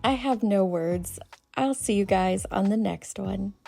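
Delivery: normal talking voice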